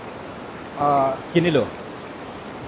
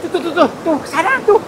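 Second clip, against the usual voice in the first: high, rushed tuh tuh tuh, much higher; excited pointing out; 11 semitones higher than usual. Real, not acted. Spotting the creature in the dark, high and excited.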